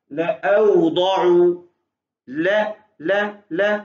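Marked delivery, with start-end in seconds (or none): 0.1-1.5 लअवदउ said very slowly, alif silent, no long ला; correct reading. Shown as a demonstration. Teacher shows the student the right reading, slow and clear. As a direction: read लअवदउ without lengthening the ला. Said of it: "क्या हो जाएगा".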